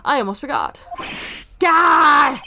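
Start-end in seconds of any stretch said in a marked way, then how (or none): 1.6-2.4 startled yelp. Real, not acted. Startled by woman's return.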